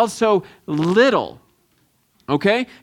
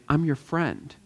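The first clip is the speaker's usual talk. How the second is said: softer, gentle reassurance to a friend; softened wizard; 4 semitones lower, 8 dB quieter than usual. Retold or imitated. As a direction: melt from power into kindness. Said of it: "all of a sudden he softens".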